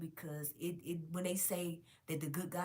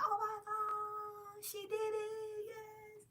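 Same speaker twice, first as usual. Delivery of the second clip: slow, high, drawn-out awe fading softer; stretched in disbelief and joy; awed; 3 dB quieter, much slower than usual. Retold or imitated. Vlogger reliving the movie ending, slow high awed gasp.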